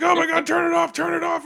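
hammed-up panic at the fire; panicked yell. Fire starts, Han panics.

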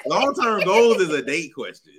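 ordinary talk, nothing marked

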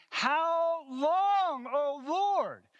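very high, loud martyrs' plea; impassioned, strained shout of pleading; crying out. Acted. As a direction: cry to heaven at full voice. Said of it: "are crying out day and night".

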